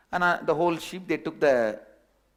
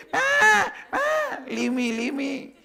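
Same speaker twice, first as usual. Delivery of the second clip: bleating like a goat; 11 semitones higher, slower than usual. Acted. Cub caught by the lion bleats to be released, high frightened goat cries. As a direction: bleat it out like a frightened kid goat begging to be let go. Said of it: "started bleating same way".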